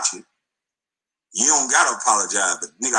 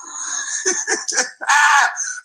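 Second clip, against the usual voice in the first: a big, high whooping laugh burst. Real, not acted. Loud laugh at his own jab.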